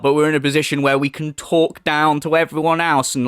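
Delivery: ordinary talk, nothing marked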